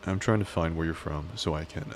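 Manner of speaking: usual speaking voice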